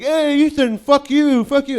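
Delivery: high mocking taunt through the door; sing-song high jeering yell; taunting bully. Bullies banging on his door.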